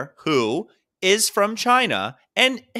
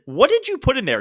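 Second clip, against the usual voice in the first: retold disbelief; 10 semitones higher than usual. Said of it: "I said".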